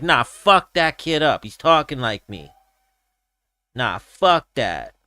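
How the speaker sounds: drawled, dismissive imitation of a Diaz accent; Diaz brother. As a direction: wave it off in a put-on Diaz accent. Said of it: "or they're gonna be like".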